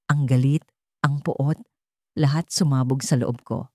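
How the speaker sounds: ordinary delivery, nothing marked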